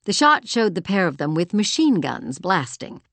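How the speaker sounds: plain reading voice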